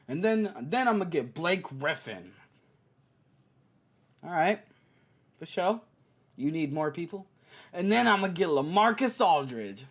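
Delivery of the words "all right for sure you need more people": mock agreement. Mock agreement to Blake Griffin.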